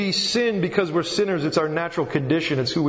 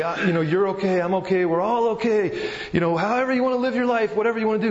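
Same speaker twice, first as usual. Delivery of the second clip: high, quick, carefree mock voice, higher pitched; mock breezy reassurance; 5 semitones higher, faster than usual. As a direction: parody a careless everything's-fine attitude. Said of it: "I can't come up to somebody and say".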